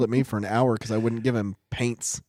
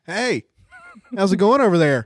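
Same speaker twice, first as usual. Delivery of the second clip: put-on high, louder kid calling across; boy's friendly holler, retold; imitating a kid's yell; 8 semitones higher, 7 dB louder than usual. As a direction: call out cheerfully to strangers. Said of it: "yell at that family".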